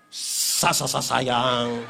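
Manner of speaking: low, very slow laughing stutter; stammering tease. Pretend. Husband stammering darling while caressing curly hair, slow laughing stutter. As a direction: draw it out like a flustered lover.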